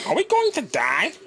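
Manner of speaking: high, frightened squeal; panicked overbite voice. Acted. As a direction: panic like the train will crash.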